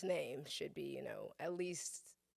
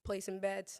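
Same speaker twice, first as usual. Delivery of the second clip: normal talking voice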